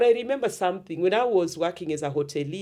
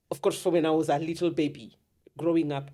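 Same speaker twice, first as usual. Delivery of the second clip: ordinary delivery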